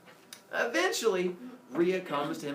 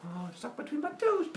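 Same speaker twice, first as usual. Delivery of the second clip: playful smashing giant; 11 semitones higher than usual. Acted. Kronos stomping tiny men underfoot, playful high giant voice. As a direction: do a giant's silly squeal.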